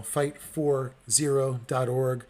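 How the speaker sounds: FIGHT, FOR, ZERO, DOT, ORG said slowly, word by word; "fight ... for ... zero ... dot org", spaced out for listeners; slow, spelled-out address. Real, not acted. Repeats the web address for listeners.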